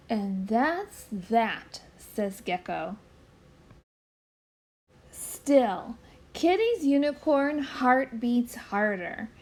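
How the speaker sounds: put-on gentle storybook read-aloud for children; story narration. A narrator's reading. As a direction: read it like bedtime to little ones. Reads picture book aloud.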